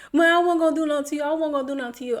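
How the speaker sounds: a hint of the other guy brushing it aside, fast; him laughing it off. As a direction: toss it off like it was all a joke. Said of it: "He's laughing".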